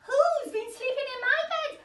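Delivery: a mother bear startled and cross; Mummy Bear. Acted. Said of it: "said Mummy Bear".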